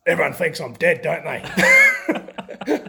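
loud, high impression of the coach; Grant Russell. Coach back from near death phones player, loud high impression. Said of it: "That's my best Grant Russell voice".

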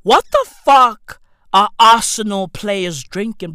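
usual speaking voice

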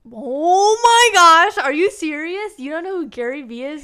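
can't believe her friend doesn't know him; shocked disbelief. Real, not acted. Stunned to hear her co-host has never heard of Gary Vee.

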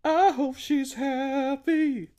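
high, slow sugary wish; mock sweet. Wishing an actress well, high and saccharine. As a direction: coo it with fake sincerity.

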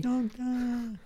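high, long-held call through glass; Donna Noble. Acted. Co-host calling to the Doctor through a window, distant shout. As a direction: pretend far-off shout at a window. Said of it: "That was me shouting through a glass window".

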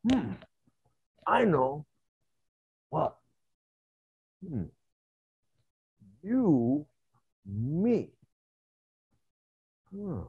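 halting one-word primitive speech; ape man with some language. Retold or imitated. Ape man figuring out you and me, halting primitive voice. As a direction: work it out slowly, word by word. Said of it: "a different version of an ape man".

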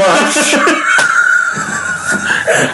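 long high laugh; real high-pitched laughter. Real, not acted. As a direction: crack up at the joke. Cracks up at pillow joke.

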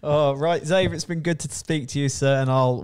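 normal talking voice

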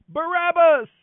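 high, loud one-word shout; crowd shout. A narrator's reading. Crowd answers the governor's question, shouting back. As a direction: shout it out like a mob. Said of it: "the crowd shouted back their reply".

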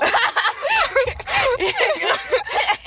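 long high giggle. Real, not acted. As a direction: giggle hard at the memory. Laughing at her own story.

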